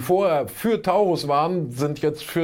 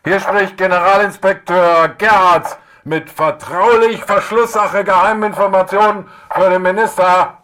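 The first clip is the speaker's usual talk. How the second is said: military report shout; 6 semitones higher, 11 dB louder than usual. Acted. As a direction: shout it like a soldier reporting to a general. Mock military report to minister.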